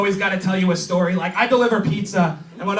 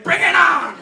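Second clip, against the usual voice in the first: high, raised challenge; mock battle cry; 9 semitones higher, 4 dB louder than usual. Woman charging at raccoons, mock battle cry. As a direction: holler it like charging into a fight.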